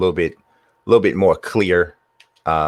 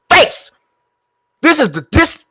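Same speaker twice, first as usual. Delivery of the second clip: excited yelling; 5 dB louder than usual. Real, not acted. Gamer yelling mid-play.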